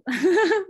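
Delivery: loud, high little burst of laughter; short laugh. Real, not acted. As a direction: let a quick giggle escape. Laughs mid-explanation.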